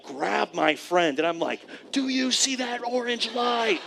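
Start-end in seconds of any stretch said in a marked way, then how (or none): 1.9-3.8 panicky question. Retold or imitated. Panicking in failing plane.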